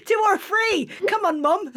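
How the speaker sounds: put-on high whiny teenager through laughter; teen voice, laughing. Host teasing the guest by mocking her kids. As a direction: mimic a pleading teen while giggling. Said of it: "it's so funny not to reference".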